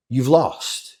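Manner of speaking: ordinary delivery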